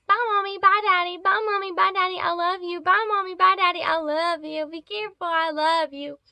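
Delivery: high, loud sing-song mockery of a child's goodbyes; mocking teacher. Teacher mocking a student's goodbye, high and taunting. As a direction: mimic the teacher's taunting baby voice. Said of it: "making fun of me".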